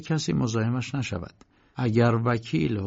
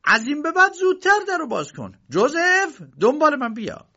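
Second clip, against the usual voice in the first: high, loud, angry orders; angry uncle; 4 dB louder than usual. A narrator's reading. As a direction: order them about in a raised, irate voice. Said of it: "عموی کی با عصبانیت وارد شد". (دستور دادن با عصبانیت و صدای بلند)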